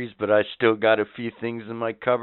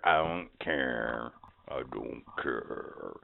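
slow, lower, fading echo of the line; echo effect; 12 semitones lower, 12 dB quieter, much slower than usual. Shown as a demonstration. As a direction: let it fade out like an echo. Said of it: "put some kind of sound effect behind that".

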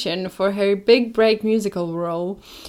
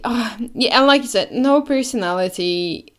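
ordinary talk

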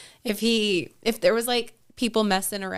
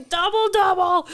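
high, loud hammed-up order; comic shouted coffee order; mock yelled order; 10 semitones higher, 5 dB louder than usual. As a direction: holler it playfully into the drive-thru. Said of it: "now I gotta yell".

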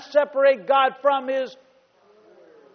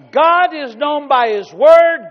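slow proclamation; 4 semitones higher, 9 dB louder, slower than usual. Acted. Sermon emphasis.

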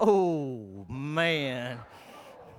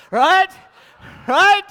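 ordinary talk

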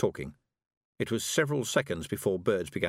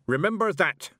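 louder, higher sharp command; stern old witch's reminder; 10 semitones higher, 6 dB louder than usual. A narrator's reading. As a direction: order it sharply. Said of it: "said Mistress Weatherwax sharply".

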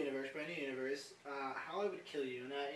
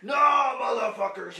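loud, booming mock announcement; hammed-up loudspeaker taunt; 8 semitones higher, 16 dB louder, slower than usual. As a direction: shout it out over the speakers. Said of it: "you hear it over the loudspeakers".

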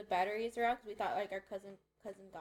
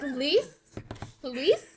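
calling out; 4 semitones higher, 8 dB louder than usual. Retold or imitated. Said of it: "She's like".

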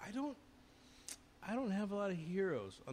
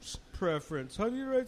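high, halting nervous questions; a starstruck kid's nervous questions, pitched high; nervous young interviewer; 8 semitones higher, 4 dB louder than usual. Retold or imitated. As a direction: stammer it like a starstruck kid. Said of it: "And I was like".

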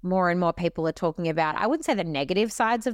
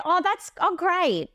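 fake enthusiasm; 11 semitones higher, slower than usual. Mock bored reply to boring news.

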